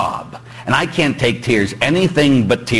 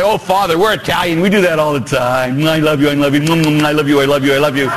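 gushing Italian parishioner. Retold or imitated. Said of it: "Or you could sit there and say".

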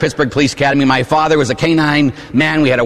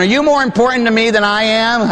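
mock question to husband; 8 semitones higher than usual. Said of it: "You ask your husband".

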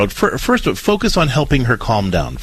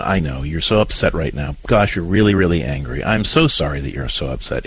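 empathetic soothing; 6 semitones lower than usual. Shown as a demonstration. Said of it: "you're just being empathetic".

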